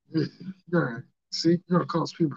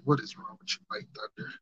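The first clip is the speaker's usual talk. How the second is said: imagined listener; 11 dB quieter than usual. Retold or imitated. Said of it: "Y'all probably looking at me like".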